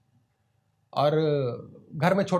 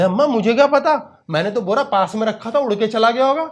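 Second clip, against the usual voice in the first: higher, louder innocent protest; defensive child; 5 semitones higher, 6 dB louder than usual. Acted. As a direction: play dumb, caught out. Child lies to mother when caught.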